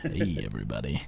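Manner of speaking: low, husky, come-hither greeting; deep sexy voice. Pretend. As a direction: play up the deep sick voice. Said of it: "deeper, sexy voice this week".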